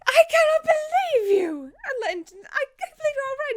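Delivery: high, loud exclamation; acted high-pitched outburst; outraged relief. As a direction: scold in disbelief, overcome with relief. Jin slaps then hugs Vin.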